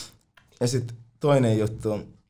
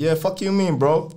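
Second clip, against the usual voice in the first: imitating convincing swagger; 6 semitones higher, slower than usual. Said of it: "vitun uskottavasti".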